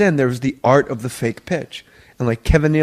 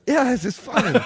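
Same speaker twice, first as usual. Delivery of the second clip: higher, unsure mock host voice; clueless host; 8 semitones higher than usual. Writer mocks the host who believes the fake pitch, higher and unsure. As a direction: play the host pretending to get it, pitched up. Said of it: "And the host would go".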